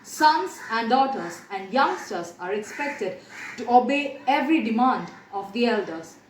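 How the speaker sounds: usual speaking voice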